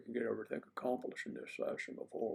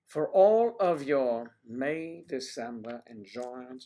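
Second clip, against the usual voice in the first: loud drawn-out plug; 9 dB louder, slower than usual. Acted. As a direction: stretch out May loud and slow.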